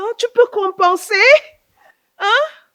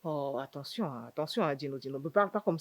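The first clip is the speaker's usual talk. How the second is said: teasing warning; 13 semitones lower, 8 dB quieter than usual. Acted. Teasing big sister softly.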